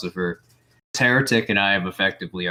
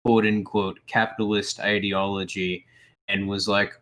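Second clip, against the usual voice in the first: ordinary delivery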